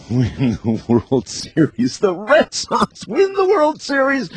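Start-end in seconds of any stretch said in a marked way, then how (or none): 2.0-4.3 high, thrilled announcement; excited. Real, not acted. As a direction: announce it like a big win. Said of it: "celebrates with ding chant".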